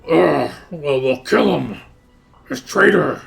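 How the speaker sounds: loud, threatening orc growl; angry orc. Acted. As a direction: condemn the captive as a furious orc. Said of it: "these orcs that are, like, holding him, it's like".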